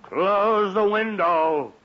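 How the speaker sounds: slow, patronising emphasis; slow sarcastic instruction. Acted. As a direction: spell it out to the boy as if it were obvious. Father spells out obvious fix.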